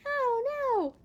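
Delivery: high, drawn-out little wail; mock dismay. Reader reacting to alligator mischief, high mock dismay. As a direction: wail it high without getting loud.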